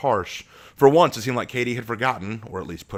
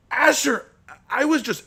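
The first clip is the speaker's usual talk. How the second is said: much higher, pleading; frightened stammer; 3 dB louder than usual. Acted. Abraham on the sand pleading with Asher, frightened.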